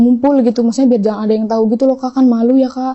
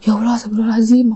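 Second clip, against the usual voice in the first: quick startled astagfirullah, under the breath; shocked gasp of prayer; faster than usual. Retold or imitated. Reading the mother's chats, she reacts in quick shock. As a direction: blurt it out in shock, fast. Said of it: "aku langsung kaget".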